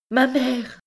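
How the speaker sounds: high, anguished call for mother; cry of distress. A narrator's reading. Egyptian girl seeing the soldiers approach, cry of distress. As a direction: call out high in sudden terror. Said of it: "cria-t-elle avec un inexprimable accent de détresse".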